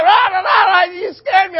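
very high, loud squawking gibberish; yelling, scolding grandma. Retold or imitated. Frightened grandma scolds grandson, high mock yelling. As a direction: imitate a frantic telling-off at full volume. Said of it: "she just yelled at me".

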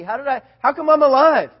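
loud, high, amazed excitement; reliving it with rising amazement; fired up. Retold or imitated. Boy finds himself unscratched after the fall, loudly fired up. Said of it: "I was so fired up".

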